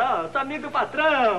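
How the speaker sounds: high-pitched mock boast; boasting at home. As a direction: brag about being the boss's pal, pitched well up. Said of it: "porque a gente diz em casa". (se gabando, com voz bem aguda)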